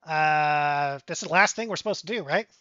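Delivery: quick, chatty little reply; little mouse. Acted. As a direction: pipe up as a small mouse. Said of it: "And the mouse goes".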